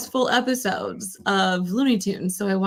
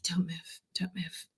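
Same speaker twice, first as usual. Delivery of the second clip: very soft whispered warning; furtive whisper, barely audible; hushed plea; 4 semitones lower, 12 dB quieter than usual. Acted. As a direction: whisper it as if nobody must hear. Quoting a furtive plea to hold still.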